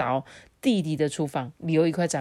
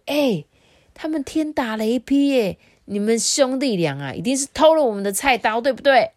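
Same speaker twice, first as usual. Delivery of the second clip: much higher and louder, faster near the end; a neighbour pointing a finger and shouting; accusing crowd shout; 7 semitones higher, 6 dB louder than usual. Acted. Townsfolk accusing the brothers of stealing their knives, shouting.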